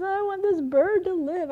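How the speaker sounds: put-on high, plaintive little-boy whine; imitated crying child. Small boy pleading for the dead bird, mock tearful. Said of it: "and he's crying".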